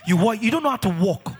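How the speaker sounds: ordinary delivery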